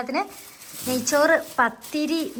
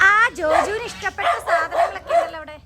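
calling out loud; 8 semitones higher, 8 dB louder than usual. Real, not acted. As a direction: call across to Joju, loud and high. Calling out to Joju outdoors. (ജോജുവിനെ ഉറക്കെ വിളിച്ചു പറയുന്നു)

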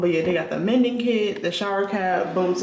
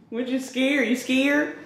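ordinary talk

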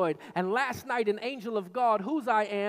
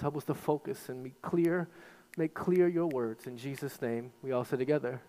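prayer; 6 semitones lower than usual. Acted. Pastor praying over the congregation, low and earnest.

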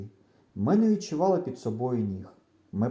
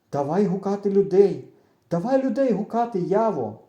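rising, louder pleading to call for help; desperate plea; 6 semitones higher, 5 dB louder than usual. A narrator's reading. Boy begging his friend to shout for help, panicky. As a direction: beg him, near panic.

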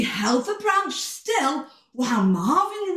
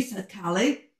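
ordinary delivery, nothing marked

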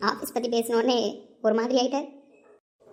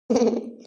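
short laugh; 4 dB louder than usual. Acted. Laughs at Hitler joke.

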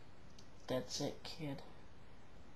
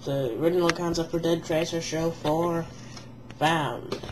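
announcer voice; 8 dB louder than usual. Acted. Mock news announcement opening.

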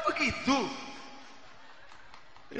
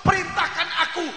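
loud, higher shouting angel; angry angel; 6 semitones higher, 8 dB louder than usual. Acted. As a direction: demand it with fury as the angel. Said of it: "malaikat berkata apa". (malaikat berteriak marah)

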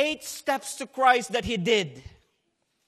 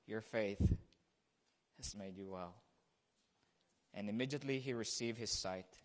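hushed reverence; 12 semitones lower, 12 dB quieter than usual. Acted. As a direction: draw them in with a near whisper.

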